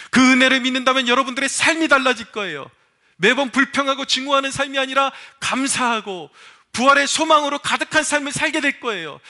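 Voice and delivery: preacher soaring into a blessing; rising preaching fervor. Acted. Sermon climax, blessing the congregation.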